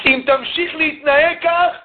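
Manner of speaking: high, slow scolding warning; scolding father. Retold or imitated. Father scolds his son, slow high warning. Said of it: "האבא צועק על הבן שלו".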